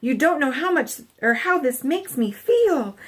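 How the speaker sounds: squealed. A narrator's reading. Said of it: "he squealed".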